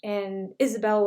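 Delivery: ordinary talk, nothing marked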